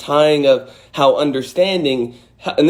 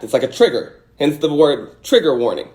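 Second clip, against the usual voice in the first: ordinary delivery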